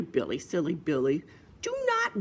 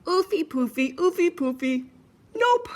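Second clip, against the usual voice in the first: high piggy chorus voice; answering piggies; 10 semitones higher, 4 dB louder than usual. Acted. Other piggies turning down the soap, high character voices. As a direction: pitch it up, ending on a very high refusal. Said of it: "But the other piggies answered".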